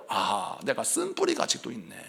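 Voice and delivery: quiet repentant realization. Retold or imitated. Believer repenting of bitterness, softly hushed. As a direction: breathe it out as a humble confession. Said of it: "이러면서 회개해야 합니다".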